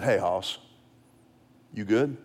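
soft, low and kind after the scolding; gentle check-in. Retold or imitated. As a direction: reassure the boy quietly. Father checks on son after punishment.

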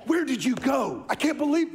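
put-on half-hearted angry mom snapping at her kid; mom's rage. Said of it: "go from relief to rage".

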